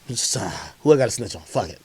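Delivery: ordinary delivery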